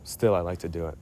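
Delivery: ordinary talk, nothing marked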